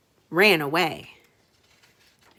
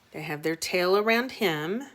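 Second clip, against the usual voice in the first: usual speaking voice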